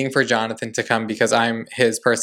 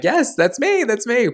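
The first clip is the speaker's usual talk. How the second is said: much higher, bursting with delight; thrilled squeal; 11 semitones higher, slower than usual. Real, not acted. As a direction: squeal it like a starstruck fan. Excitedly confirming to drag queen.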